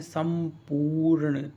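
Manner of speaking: संपूर्ण spelled out very slowly, each syllable apart; slow teacher's spelling-out of संपूर्ण. Shown as a demonstration. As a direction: stretch संपूर्ण out slowly for dictation. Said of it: "संपूर्ण इस तरह लिखते हैं".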